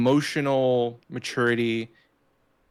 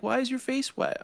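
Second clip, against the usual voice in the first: put-on child's question; 11 semitones higher, 4 dB quieter than usual. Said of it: "He's like".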